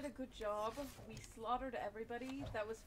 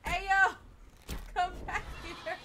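loud shout, higher; calling out loud; 5 semitones higher, 6 dB louder than usual. Real, not acted. Gamer calling teammates back, loud shout. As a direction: yell across the field to the team.

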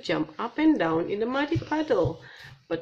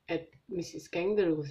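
ordinary delivery

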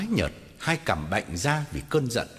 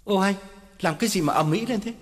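higher, fast flustered excuse; flustered protest; 7 semitones higher, faster than usual. Acted. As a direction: hurry to hush her up. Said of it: "ú ớ phân trần". (ú ớ phân trần, vội vàng)

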